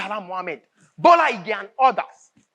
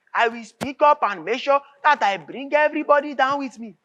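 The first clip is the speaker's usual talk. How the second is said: usual speaking voice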